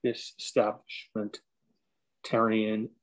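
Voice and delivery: stretched out slowly; slow syllable by syllable. Shown as a demonstration. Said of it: "let me see".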